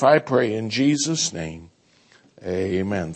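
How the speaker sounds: prayer. Acted. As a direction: pray gently over the church. Pastor leads congregation prayer.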